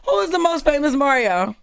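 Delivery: amused and incredulous; laughing retort. Real, not acted. Mocks the Mario claim.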